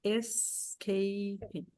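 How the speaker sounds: "Escaping" drawn out very slowly syllable by syllable; teacher spells out the syllables by ear; three syllables. Shown as a demonstration. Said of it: "São três sílabas".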